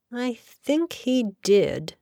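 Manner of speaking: slow pondering remark; thoughtful. A narrator's reading. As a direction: let the words drift as you think back. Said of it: "said Jack thoughtfully".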